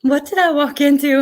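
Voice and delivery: you just stumbled into a mess; startled. Real, not acted. Streamer catching up on chat, startled and loud.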